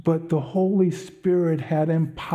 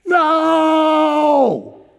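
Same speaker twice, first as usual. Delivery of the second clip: loud, very high shouted refusal; angry shout; 13 semitones higher, 10 dB louder than usual. Retold or imitated. A woman refusing the offered tract, shouting loud and high. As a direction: bark a flat no at a stranger.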